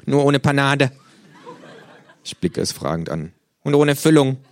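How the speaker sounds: high, loud cheeky kangaroo greeting. Acted. The kangaroo scolds the late narrator in its high cheeky voice. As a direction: snap it out as the brash kangaroo.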